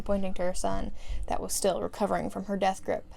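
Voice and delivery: ordinary delivery, nothing marked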